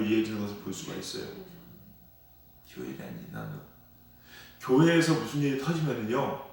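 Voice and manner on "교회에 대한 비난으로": much lower and softer, almost under the breath; 교회에 대한 비난으로 repeated low and hushed; quiet echo. Real, not acted. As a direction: let the phrase sink in a hushed echo. Preacher echoes key phrase softly.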